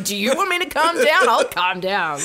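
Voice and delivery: mock furious outburst. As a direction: fling the words back in exasperation. Mock adult told to calm.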